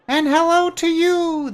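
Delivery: very high, slow, chirpy little fairy voice; chirping creature. Acted. Tiny winged creature greets the traveller, high and chirpy. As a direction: squeak it out like a tiny bird-sized sprite. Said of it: "the creature replies, chirping".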